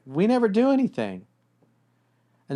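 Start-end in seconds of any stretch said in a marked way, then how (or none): 0.1-1.3 high, louder whiny complaint; imitating a wife who feels neglected; girlfriend complaining. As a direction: mimic the girlfriend's exasperated complaint. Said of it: "your girlfriend or your wife is like".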